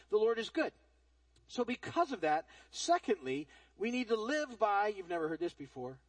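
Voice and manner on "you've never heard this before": dry, low sarcastic aside. Real, not acted. Preacher teasing congregation about a line he repeats often, sarcastic. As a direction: drop it in lower, as a sarcastic aside. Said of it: "I say that sarcastically".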